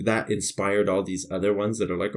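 ordinary talk, nothing marked

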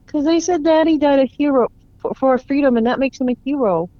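put-on high, loud little boy voice, speeding up; a young boy insisting through grief; child voice. Grieving boy speaks of his father.

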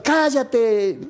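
mock indignant command, not shouted; retold quarrel. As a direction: re-enact telling someone off. Retold quarrel, silencing someone.

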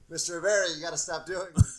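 lightly voiced police officer; mock cop. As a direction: play the cop pleading with the old man. Cops voiced in a story.

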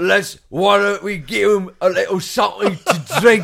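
over-the-top Cockney impression of a movie warrior; full Cockney. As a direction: drag it out in broad, hammed-up Cockney. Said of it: "he went full Cockney on that".

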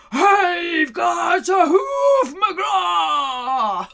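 slow triumphant yell, high and loud. Acted. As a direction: bellow it out in glee.

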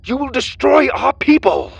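high, loud accusing shout; prisoner's yell. A narrator's reading. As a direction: yell it while struggling against guards. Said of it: "he yelled".

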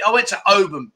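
ordinary talk, nothing marked

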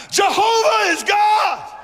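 high, drawn-out proclamation; crying out. Acted. People cry out after the fire falls, loud proclamation. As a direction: cry it out high, stretching the words. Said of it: "Cried out".

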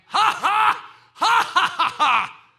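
loud, high, ringing ha ha; God's big laugh, full voice; a huge joyful laugh ringing out; booming laugh. Acted. God's laugh as the son's name.

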